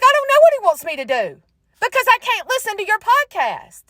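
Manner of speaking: high-pitched comic whine; exasperated retort. Retold or imitated. Woman answering a podcast clip that will not load, high exasperated whine. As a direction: wail it up high in mock exasperation.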